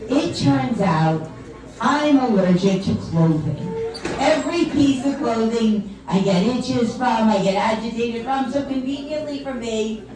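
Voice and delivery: ordinary talk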